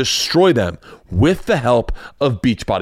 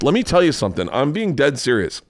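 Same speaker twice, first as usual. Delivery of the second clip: usual speaking voice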